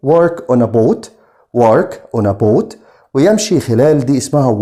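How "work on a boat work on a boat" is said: slow, clear teacher delivery; slow model phrase. Shown as a demonstration. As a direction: say "Work on a boat" slowly, word by word. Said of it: "teacher models phrase". (نطق بطيء لـ "Work on a boat")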